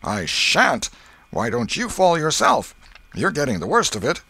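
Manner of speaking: high, loud retort; defiant. A narrator's reading. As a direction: shout back defiantly. Boys argue in fight.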